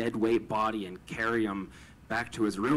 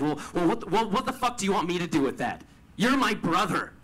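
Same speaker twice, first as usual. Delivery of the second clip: higher, louder, fast exasperated snap; raised, quickened angry voice; angry retort; 8 semitones higher, 6 dB louder than usual. Retold or imitated. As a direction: fire it back at him, unable to stop being angry.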